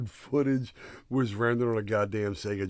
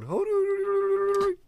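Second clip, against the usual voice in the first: mock kung fu yell; 5 dB louder than usual.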